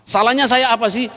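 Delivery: high, loud self-defence; indignant protest. Retold or imitated. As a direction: act out protesting your innocence. Protests that he was blameless. (protes tak terima, diperagakan)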